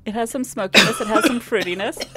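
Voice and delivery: high, strained tasting notes; forcing words through the heat; mouth burning from spicy food. Real, not acted.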